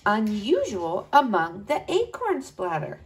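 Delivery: usual speaking voice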